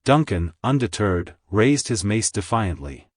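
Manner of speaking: usual speaking voice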